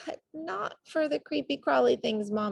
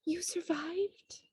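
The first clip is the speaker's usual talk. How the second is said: tender disbelief; 9 semitones higher, 7 dB quieter, slower than usual. Acted. Reunion with mother.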